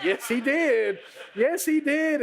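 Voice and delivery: rising, hyped repeat; mock crowd hype; excited crowd echo. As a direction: cheer it like a fan in the crowd. Crowd cheering Jesus on.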